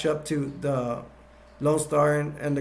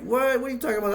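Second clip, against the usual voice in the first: much higher, incredulous mock squeak; indignant surprise; 9 semitones higher, much faster than usual. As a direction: squeak out the protest in disbelief. Dot protests Lone Star's verdict.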